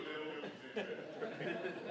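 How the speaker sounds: the laugh winding down; trailing laugh. Real, not acted. Speaker settling down after laughing at Brian, fading laugh. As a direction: let the laugh fade out.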